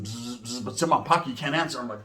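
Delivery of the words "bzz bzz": soft, low imitated phone buzz. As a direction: buzz quietly like a phone in a pocket. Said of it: "I hear my phone buzzing".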